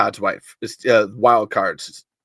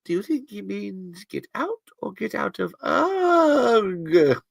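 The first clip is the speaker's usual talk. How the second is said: high, softer comic voice with a long groan; Monty Python; 8 semitones higher, 4 dB quieter, slower than usual. Acted. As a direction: puzzle it out in a silly high voice, trailing into a wail. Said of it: "Solid Monty Python joke".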